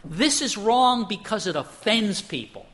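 high-pitched imitation of an offended objection; retold indignant protest. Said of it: "he was offended by this".

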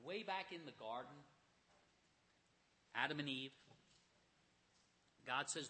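usual speaking voice

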